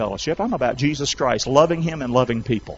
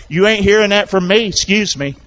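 usual speaking voice

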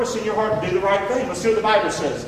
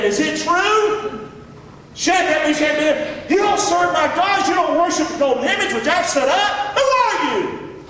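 the raging king Nebuchadnezzar, pitched high and shouting; angry king; 8 semitones higher than usual. Acted. Preacher voicing the enraged king to the three boys, high shouting fury. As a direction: bark it out like a furious king demanding answers. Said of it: "He spoke and he said unto them".

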